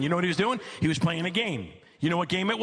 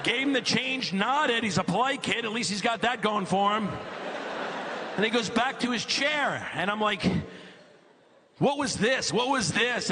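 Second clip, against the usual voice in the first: much higher, worked-up disbelief; exasperated rant; 9 semitones higher than usual. Real, not acted. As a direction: rant about the lazy game like it's a crime. Dad's mounting exasperation telling the story.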